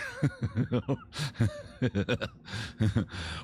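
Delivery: long, loose chuckle; drawn-out real chuckle; laughing. Real, not acted. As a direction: laugh at your own joke, letting it run on. Laughing at his own joke.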